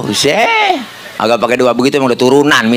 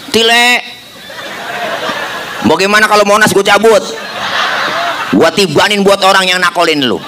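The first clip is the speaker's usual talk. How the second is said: eager Jibril; 5 semitones higher than usual. Acted. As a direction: hype up the absurd offer with a laugh. Jibril offers to preacher, joking. (Jibril menawarkan dengan suara tinggi dan semangat)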